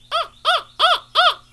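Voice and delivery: high, loud cawing "Ah, ah"; crow caw. Shown as a demonstration. Bird caller demonstrates the northern crow, loud high caws. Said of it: "the northern crow goes".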